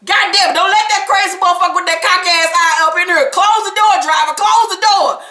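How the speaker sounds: high, mock-frantic voice ordering the driver; hammed-up panic, shoo him away; imitating a frantic woman. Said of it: "she was like".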